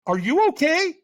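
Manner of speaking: mock puzzled, high-pitched question; concerned stranger. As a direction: lightly act out a baffled, concerned onlooker. Funny look, retold stranger asks.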